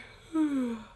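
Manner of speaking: long exhaled sigh out loud; audible release sigh. Shown as a demonstration. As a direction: sigh it all out. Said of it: "sigh or yawn into every cell".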